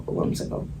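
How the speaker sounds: hushed whispered repeat; whispered echo. Acted. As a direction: drop to a ghostly whisper.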